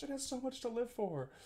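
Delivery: high, soft voice for the mustache. Pretend. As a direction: speak as the mustache, a little pitiful. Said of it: "personifying my mustache".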